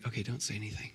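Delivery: telling doctor to keep quiet; hushed aside. Retold or imitated. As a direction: keep it secret, voice way down.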